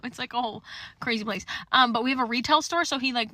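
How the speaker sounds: ordinary talk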